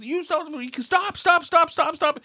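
high-pitched imitation of a furious pursuer; mock angry yelling. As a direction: mimic yelling from afar without real volume. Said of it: "this guy was really loud".

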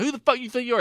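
high-pitched outraged retort, not shouted; mock indignant challenge. Friend retelling the kid challenging the man, mock indignant. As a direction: play the affronted kid, pitch jumping up.

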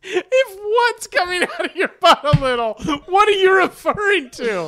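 high, loud, laughing through it; laughing disbelief. Real, not acted. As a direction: squeal the question through laughter.

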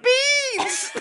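very high, drawn-out mock scream. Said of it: "I'm screaming".

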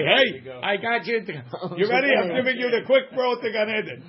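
over-the-top cheerful hitman, chuckling; mock eager killer. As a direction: play a gleeful killer handing out a shortcut to heaven, laughing. Mock killer offering quick paradise.

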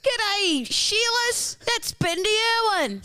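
put-on Australian accent. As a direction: yell it like an Aussie larrikin.

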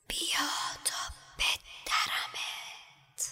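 slow, cold, hissing whisper from nowhere; icy venomous voice. A narrator's reading. Unseen monster calling Harry, slow icy whisper. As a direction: breathe each threat out slowly like ice. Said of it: "مثل یخ سرد و زهراگین بود".

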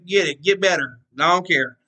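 usual speaking voice

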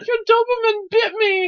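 high-pitched, loud mock accusation; hysterical old lady. Old lady claiming a dog bite, hysterical shriek. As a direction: wail it in hammed-up outrage. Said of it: "She's like".

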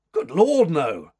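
loud, high exclamation falling away; startled denial. A narrator's reading. Rescuer denying he killed the man, emphatic. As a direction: burst out in shocked denial.

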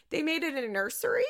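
high-pitched, laughing through the words; real laughing. Real, not acted. Podcaster revealing the couples got a nursery, laughing.

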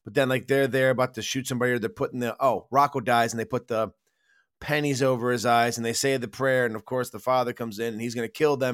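ordinary delivery

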